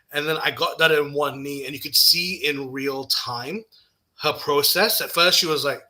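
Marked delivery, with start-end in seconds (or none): none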